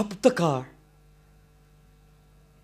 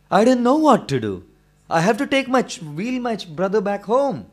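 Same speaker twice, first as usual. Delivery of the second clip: desperate plea; 5 semitones higher, 6 dB louder than usual. Acted. Desperate boy pleads.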